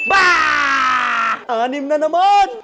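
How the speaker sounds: long loud "bah" yell; drawn-out cheer as the ball drops; excited cheer. Real, not acted. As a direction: celebrate the shot at full voice. Celebrates a scored basket.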